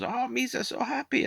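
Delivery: very high, squeaky cartoon creature voice; Jar Jar Binks. Reviewer mocking the film's childish alien, high silly voice. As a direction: mimic a silly alien in falsetto. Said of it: "Jar Jar Binks, and he goes".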